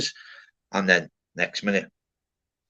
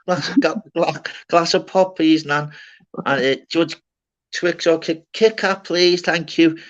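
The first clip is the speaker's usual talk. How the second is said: put-on higher, sweet little-boy politeness at nan's; polite nan's voice; 7 semitones higher, 4 dB louder than usual. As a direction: put on the good-grandchild voice, pitched up. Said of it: "my nan's voice for my work".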